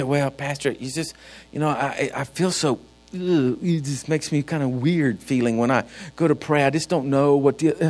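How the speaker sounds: low, soft, hesitant mock churchgoer; reluctant churchgoer. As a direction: mumble an awkward excuse, imitating a shy parishioner. Voices a reluctant, uneasy churchgoer.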